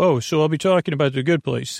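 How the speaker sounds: usual speaking voice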